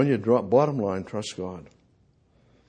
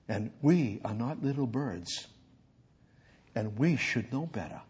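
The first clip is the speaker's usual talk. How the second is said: soft, low, reflective sermon voice; quiet, intimate reflection to the congregation; hushed preaching; 3 semitones lower, 6 dB quieter than usual. Real, not acted. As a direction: bring it right down to a quiet close.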